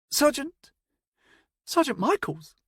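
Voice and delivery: can't believe who it is; incredulous. Acted. Nevin recognising his old sergeant, high and incredulous. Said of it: "he asked incredulously".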